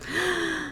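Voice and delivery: sharp, high gasping breath in; a big dramatic intake of breath; deep gasp. Shown as a demonstration. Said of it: "That was a deep breath sound effect".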